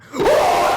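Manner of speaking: high sharp gasp of fright; shocked gasp. Acted. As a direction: gasp in terror. Principal sees monster.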